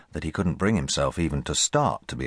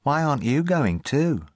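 put-on boy's voice; 9 semitones higher, 4 dB louder than usual. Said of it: "said Rupert".

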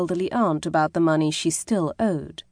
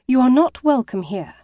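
raised, higher and sharper, a firm stern dismissal; stern rebuff; 6 semitones higher, 4 dB louder than usual. A narrator's reading. Maddie orders the man who betrayed her out, sternly. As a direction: push him away with a cold firm voice.

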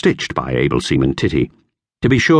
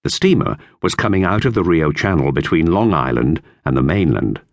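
plain reading voice

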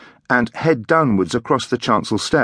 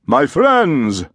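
a mild ringing call to the room; firm ringing voice; 4 semitones higher, 3 dB louder than usual. A narrator's reading. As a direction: call out firmly to the congregation. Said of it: "the magnificent voice rang out firmly".

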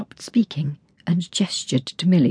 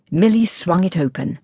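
ordinary delivery

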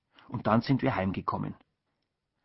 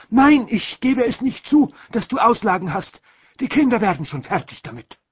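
a stingy aunt insisting; aunt's refusal; 10 semitones higher, 7 dB louder than usual. A narrator's reading. Aunt refusing to pay the porter, high and insistent.

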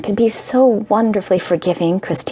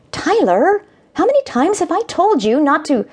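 exasperated mother; 11 semitones higher than usual. Acted. Mother snapping at son.

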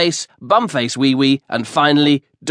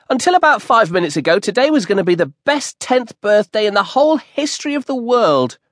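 put-on higher, fast, eager ten-year-old boy; excited boy; 8 semitones higher than usual. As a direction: rush it out like a thrilled kid on his birthday. Compton's excited dialogue voice.

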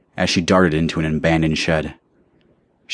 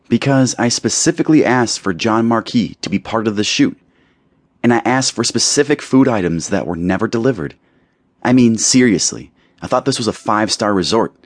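higher pitched, haughty whining complaint; petulant young model, voice pitched up; spoiled model complaining; 6 semitones higher, 3 dB louder than usual. Acted. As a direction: play the pampered star listing grievances. Taylor complains about the shoot.

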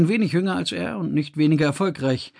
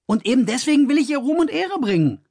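higher, earnest assurance; assuring; 11 semitones higher, faster than usual. A narrator's reading. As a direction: Kors assures Emde he does it for his sister, earnest. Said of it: "versicherte Kors".

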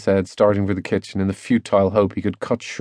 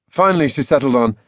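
usual speaking voice

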